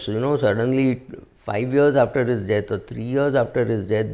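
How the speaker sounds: ordinary delivery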